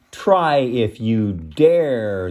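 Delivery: slow, drawn-out challenge; mild storybook bellow from a big goat; bellowed dare. A narrator's reading. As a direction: dare him, stretching each word. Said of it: "goat bellowed right back".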